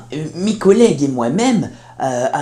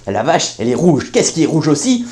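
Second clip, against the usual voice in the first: ordinary delivery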